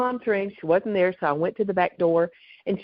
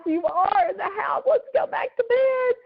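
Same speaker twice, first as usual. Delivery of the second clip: retold exasperated insistence; 12 semitones higher than usual. Daughter to confused mother at the door, retold exasperated insistence. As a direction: imitate pleading with a confused parent.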